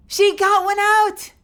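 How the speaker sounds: high, loud, slow delight; excited shout. Real, not acted. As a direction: cheer it out. Pet finally squeaks.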